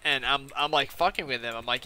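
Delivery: ordinary talk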